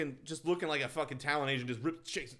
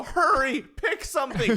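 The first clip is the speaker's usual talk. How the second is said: high, loud hurrying; mock urgent yell; 9 semitones higher, 8 dB louder than usual. Imitating the owner rushing her dog, urgently loud.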